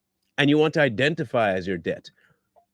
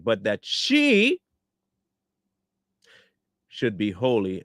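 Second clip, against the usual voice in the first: deliberate scripture recital; scripture reading; 4 semitones lower than usual. Acted. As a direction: read the verses like a preacher at the pulpit. Reads scripture passage aloud.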